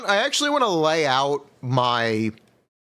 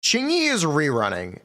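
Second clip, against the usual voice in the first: usual speaking voice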